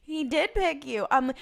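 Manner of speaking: normal talking voice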